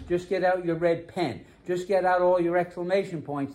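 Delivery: ordinary talk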